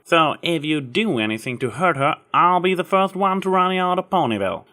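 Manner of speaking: warning. A narrator's reading. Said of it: "continued with a warning".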